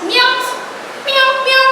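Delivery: high-pitched little meows; cat's meow. Shown as a demonstration. Teacher showing children how a cat sounds, lightly imitated high meows.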